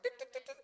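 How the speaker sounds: high, soft ticking game noise; Pac-Man sound effect. Acted. Preacher imitating Pac-Man eating the snakes. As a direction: tick like an arcade game.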